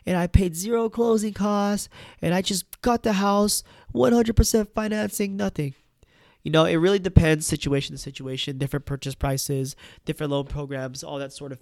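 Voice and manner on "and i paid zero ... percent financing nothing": lightly voiced friend boasting, pitched higher; a hint of a bragging buddy, raised pitch. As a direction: imitate a friend showing off a great deal, higher voice. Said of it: "you'll hear your friends say".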